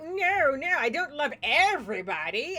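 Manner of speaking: high, loud cartoon refusal; Tiny turning down the hag; refusing Tiny. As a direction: push her away in a silly voice. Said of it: "And the Tiny's like".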